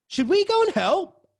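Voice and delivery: put-on worried girl. Frightened girl voiced in story.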